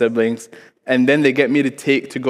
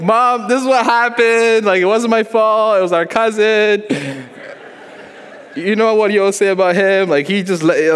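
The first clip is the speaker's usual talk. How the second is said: tearful plea; 8 semitones higher than usual. Retold or imitated. Child explaining to mom whose fault it was, in mock tears. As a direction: whine it out in a hammed-up sob. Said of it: "So then in tears".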